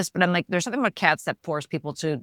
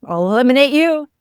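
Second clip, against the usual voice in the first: the mom's joking threat, loud; mock threat; 3 semitones higher, 7 dB louder than usual. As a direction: play the mom threatening the kid, half joking. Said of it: "And she goes".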